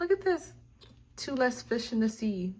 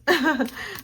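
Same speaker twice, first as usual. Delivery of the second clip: laugh; 8 semitones higher, 6 dB louder than usual. Real, not acted. Laughs after "man and or woman.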